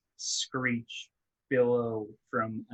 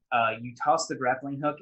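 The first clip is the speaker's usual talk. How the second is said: ordinary talk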